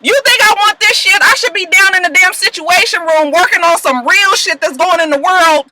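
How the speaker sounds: high, heated imitation of an angry president; mock angry rant. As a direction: rant at her like a fed-up man. Fitz ranting at Olivia, retold.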